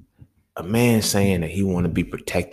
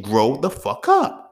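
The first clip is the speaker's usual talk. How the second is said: higher, slow, drawn-out scold; exasperated scolding; 8 semitones higher, 4 dB louder, slower than usual. Real, not acted. Scolding grown men who want protection, a fed-up drawn-out scold. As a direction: tell them off like a fed-up elder.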